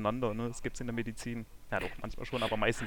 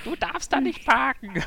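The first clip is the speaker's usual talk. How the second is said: put-on slow, much higher scolding old man; grumpy old man; 11 semitones higher than usual. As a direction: wag a finger like a cranky neighbor. Acting out grumpy neighbor scene.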